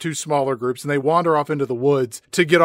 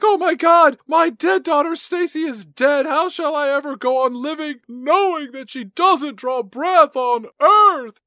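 high, loud wailing mom; hammed-up grief; 10 semitones higher, 3 dB louder than usual. Mom mourning her dead daughter, high hammy wail. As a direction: ham up a mother's grief, pitched high. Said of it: "her mom reacts as you would expect".

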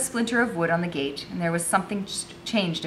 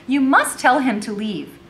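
insistent; 7 semitones higher, 6 dB louder than usual. A narrator's reading. Becky insists, scowling.

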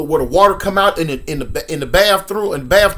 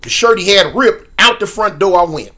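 normal talking voice

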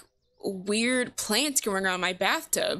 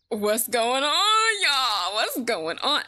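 loud greeting; 2 semitones higher, 4 dB louder, much slower than usual. Acted.

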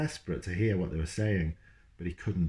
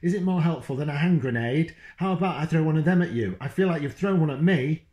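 higher, louder, fast furious tirade; furious mum; 8 semitones higher, 5 dB louder, faster than usual. Acted. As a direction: hurl it at him like a grenade. Mum's furious tirade at Dad.

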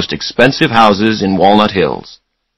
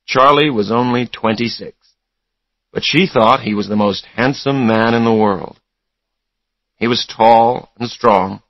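ordinary delivery, nothing marked

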